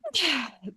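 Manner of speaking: high, falling release of breath; audible sigh, sliding down; demonstrated sigh. Shown as a demonstration. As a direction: sigh it all out. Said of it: "you've gotta sigh it all out".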